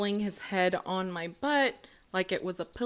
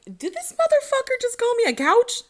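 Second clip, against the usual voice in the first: much higher and louder, outraged disbelief; indignant disbelief; 8 dB louder than usual. Retold or imitated. Reacting to being called a couch, shrill-free loud outraged disbelief. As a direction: shoot up in pitch like you can't believe your ears. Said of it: "And I'm like".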